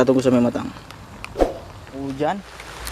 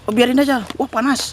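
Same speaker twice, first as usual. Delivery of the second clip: surprised; 10 semitones higher than usual. Real, not acted. Pouch gets hot in hand.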